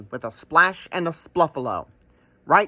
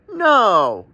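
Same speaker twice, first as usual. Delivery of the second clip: higher, louder crow cry; cawing no; 6 semitones higher, 6 dB louder than usual. Acted. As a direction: caw your answer. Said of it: "cawed the Crow".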